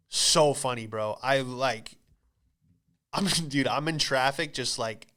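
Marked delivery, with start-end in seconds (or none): none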